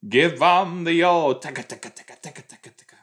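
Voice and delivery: high, loud sung taka tap imitation; tap dance sounds. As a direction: mimic tap shoes with your voice. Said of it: "She's gonna do a little number".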